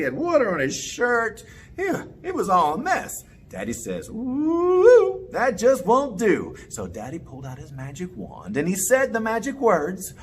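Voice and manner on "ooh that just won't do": disapproving. Acted. Daddy disapproving in the story.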